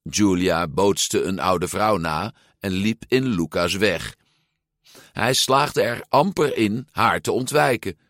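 ordinary delivery, nothing marked

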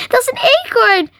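high-pitched amazement; breathless gasp. A narrator's reading. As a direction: gasp out the discovery. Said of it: "hijgde Marie".

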